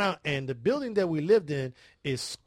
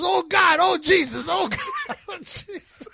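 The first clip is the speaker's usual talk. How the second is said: high-pitched imitation of screaming, breaking into laughter; mock church wailing; 13 semitones higher, 7 dB louder, slower than usual. Neighbor mimics the screams they heard, wailing and laughing. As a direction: mimic frantic wails, dissolving into laughs. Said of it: "We thought we were in church".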